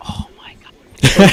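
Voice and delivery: slow, whispered exasperation; dismayed whisper. Retold or imitated. Family staring at mom in dismay, slow whisper. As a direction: whisper it slowly in disbelief.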